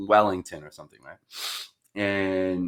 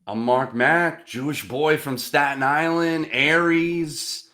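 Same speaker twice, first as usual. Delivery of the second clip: slow, hammed-up dating-ad intro; mock dating profile; 5 semitones higher, 4 dB louder, slower than usual. To the prospect, a slow hammed-up dating-profile pitch. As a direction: sell yourself like a cheesy personal ad.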